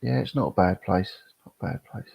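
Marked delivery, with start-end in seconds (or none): 1.3-2.1 breathy whisper, almost under his breath; whispered repeat. Real, not acted. Streamer echoing his answer to chat, whispered. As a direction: whisper "It's not a bad place." to yourself as an echo. Repeats own line to himself.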